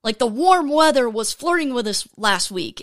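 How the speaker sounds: normal talking voice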